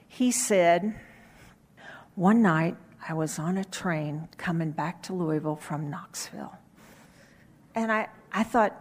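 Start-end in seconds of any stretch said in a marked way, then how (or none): 2.1-6.5 quiet, hushed telling of a train story; low voice. Retold or imitated. Preacher telling his sermon story in a low voice. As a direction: drop the voice way down and murmur it. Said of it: "in a very low voice".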